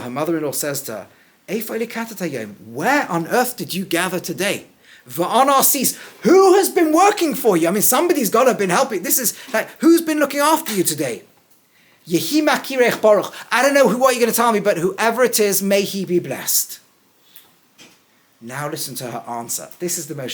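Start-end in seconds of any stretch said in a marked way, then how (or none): 1.5-9.7 much higher and louder, rushing excited questions; astonished questioning. Acted. Acting Naomi's astonished questions. 9.8-11.2 much higher and louder, astonished; excited question. Acted. Naomi's question acted out. 12.1-16.8 much higher and louder, rushing excited questions; astonished questioning. Acted. Acting Naomi's astonished questions.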